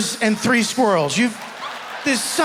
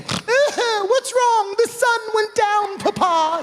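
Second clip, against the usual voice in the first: put-on high, slow little girl whining to papa; little daughter; 9 semitones higher, much slower than usual. Little girl whimpering to her papa about the sunset. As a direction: play a small girl upset at sunset.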